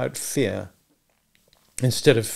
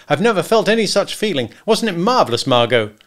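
much higher, louder elation; wildly happy; 8 semitones higher, 6 dB louder than usual. A narrator's reading. Husband shares his thrill with his wife, wildly happy. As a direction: gush with exhilaration. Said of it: "Macumber felt a wild, unreasonable happiness".

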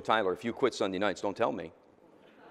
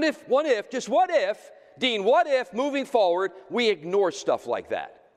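high, loud preacher's fervor; rousing pulpit preaching; 9 semitones higher, 5 dB louder than usual. Acted. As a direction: preach it out at full force to the church. Preaching to the congregation.

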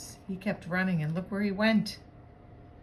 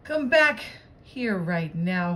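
storybook owner's raised-voice command; hollering; 8 dB louder, slower than usual. A narrator's reading. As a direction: holler for a runaway dog, spacing out the order. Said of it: "hollered Missus McNosh".

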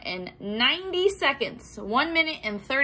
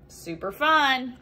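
usual speaking voice

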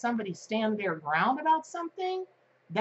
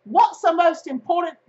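put-on high loud British accent; hammed-up posh English accent; 9 semitones higher, 10 dB louder than usual. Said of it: "No, that sounds like I'm from England".

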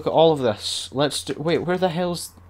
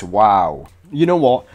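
ordinary talk, nothing marked

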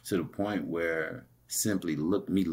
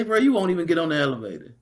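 much higher, fast mocking jab; teasing taunt; 11 semitones higher, 5 dB louder, faster than usual. Taunting the angry man stuck at the elevator, high mocking. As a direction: needle him playfully.

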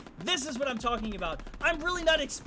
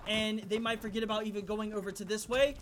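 ordinary talk, nothing marked

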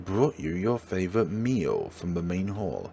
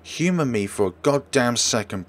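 ordinary talk

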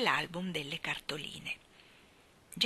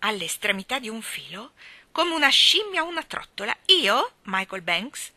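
furious; 4 semitones higher, 8 dB louder than usual. A narrator's reading. Mary Poppins furious at Michael, loud outrage. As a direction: explode in indignation. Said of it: "nella collera".

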